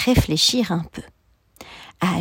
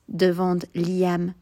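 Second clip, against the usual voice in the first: usual speaking voice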